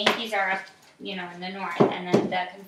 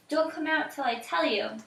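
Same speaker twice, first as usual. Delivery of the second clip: put-on higher, urgent old woman's voice; 7 semitones higher than usual. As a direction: hurry the children into hiding. Old woman orders children to stay.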